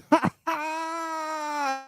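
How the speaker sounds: real, drawn-out high laugh. Real, not acted. Laughing at a viewer's chat message, high cackle.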